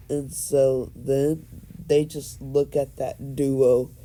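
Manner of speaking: ordinary delivery, nothing marked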